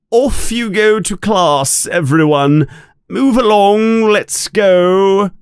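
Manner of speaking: high, carrying teacher's call. A narrator's reading. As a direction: herd everyone along to class. Teacher calling pupils to class.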